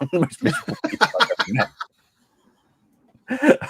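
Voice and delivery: laughing. Real, not acted. Laughing at grumpy musician story.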